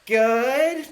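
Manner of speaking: put-on very high, loud kid voice; children. Kids answering the clown, high loud child voice. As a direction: squeal it like a keen child. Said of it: "I love that you have to play all of the children".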